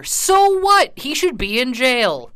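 loud, high-pitched indignant retort. Real, not acted. Arguing with people excusing a light sentence, indignant.

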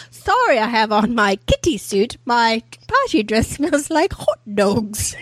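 janky, horrible mock-Australian; really stupid Australian accent. Actress as Patches apologizing in her kitty suit, awful Australian accent. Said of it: "she has this really stupid accent".

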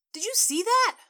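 sudden high loud shout at the game; a rowdy fan yelling at the TV. Acted. Sports fan in a diner yells at the game, loud and high.

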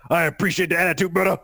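a light Mister T impression, a bit quick; lightly voiced tough guy. Host reading a Mister T comic line in a light impression. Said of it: "who's winning the impression contest".

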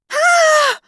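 high, loud scream; piercing high shriek on impact. A narrator's reading. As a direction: shriek in alarm. Passenger screams as car hits cans.

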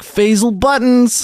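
high, slow, grandly announced name; grand showbiz name. Pretend. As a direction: present the new name like a marquee star. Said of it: "And I was like".

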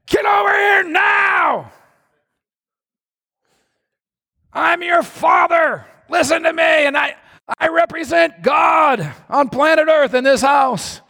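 high, drawn-out parental shout; angry father. Acted. Father ordering his children around, mock angry shouting. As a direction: bellow like an overbearing dad, hammed up. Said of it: "anger is never the way of heaven".

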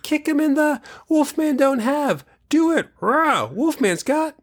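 put-on very high, excited kid lines; kid trailer voices. Recapping the commercial, high silly kid voices. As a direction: squeal the trailer lines like kids. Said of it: "that's the dumbest I've ever sounded".

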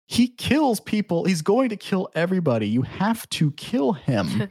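worked-up mock exasperation, starting high; exasperated insistence. Acted exasperated plea to kill.

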